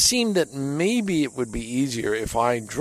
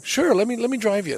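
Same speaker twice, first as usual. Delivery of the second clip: eager yes; 11 semitones higher, 4 dB louder than usual. Retold or imitated. How he should have answered.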